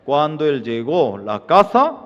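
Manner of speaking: ordinary talk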